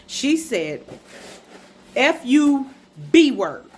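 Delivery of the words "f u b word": loud, punched censored insult; emphatic re-enacted insult with a pause in it; retold cursing out. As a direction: spit out each piece separately, louder. Retells mom cursing her out.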